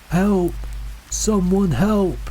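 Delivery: high, drawn-out call for help; distant cry for help. A narrator's reading. Lost man to hikers in fog, distant cry for help. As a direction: call out from far off, pitched high. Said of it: "A man's voice, distant".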